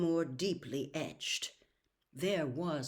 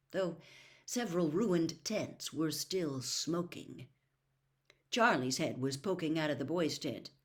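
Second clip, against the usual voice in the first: usual speaking voice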